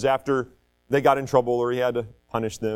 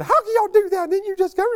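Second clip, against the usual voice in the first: high, exasperated mock scolding; mad mom; 4 dB louder than usual. Mom scolding dad and son for fighting, mock mad. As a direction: imitate an upset mom, pitched way up. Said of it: "My mom was so mad".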